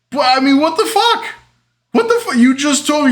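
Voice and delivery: very high-pitched, incredulous rant; outraged protest. Retold or imitated. Shortstop to brother blaming the throw, outraged high protest. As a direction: squeal in acted exasperation at the blame. Acted quarrel with brother, pissed.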